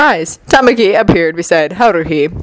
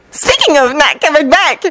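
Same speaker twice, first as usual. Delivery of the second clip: mischievous; 7 semitones higher than usual. A narrator's reading. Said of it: "with a mischievous spark".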